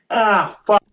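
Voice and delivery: pained yelp after biting tongue; real yelp of tongue pain; just bit tongue. Real, not acted. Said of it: "I bit my tongue when I sneezed".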